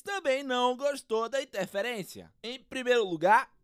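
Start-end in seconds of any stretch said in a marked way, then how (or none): 2.4-3.5 footballer interview voice. Acted. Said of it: "O craque palmeirense".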